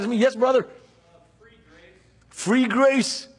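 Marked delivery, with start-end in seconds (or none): 1.4-1.9 barely voiced whisper of the words Free grace. Real, not acted. Preacher echoes the answer under his breath, whispering. Pastor repeats answer from congregation. 2.3-3.3 Free grace called out higher, in full voice; proclaimed. Real, not acted. Preacher affirms the congregation's answer, proclaiming it aloud.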